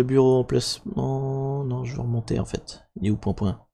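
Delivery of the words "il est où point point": soft, offhand question to self; muttered searching. Real, not acted. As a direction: mumble it while hunting for something. Muttering while searching for folder.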